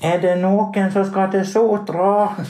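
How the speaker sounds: high, slow call down the taxi queue; drawn-out high shout for passengers. Retold or imitated. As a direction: call it out like a man at the front of a queue. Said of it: "står det en mann og roper".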